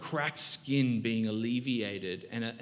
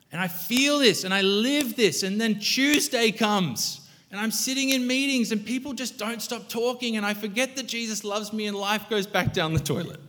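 preaching at full force; 8 semitones higher than usual. Acted. Pastor urging the church to want only Jesus, fervent preaching. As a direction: lift it up and proclaim it.

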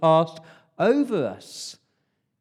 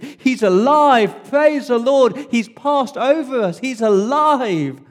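much higher, loud, slow exultant shout; joyful cry; 10 semitones higher, 9 dB louder, slower than usual. Retold or imitated. Mum finding her firstborn alive, loud joyful shout. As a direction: cry it out with overwhelming relief, drawn out.

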